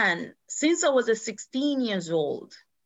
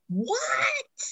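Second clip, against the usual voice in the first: a sharp, much higher, strained What; shocked what; 5 dB quieter than usual. Retold or imitated. Mother stunned by husband's accusation of her daughter, shocked cry. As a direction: yelp it out in disbelief.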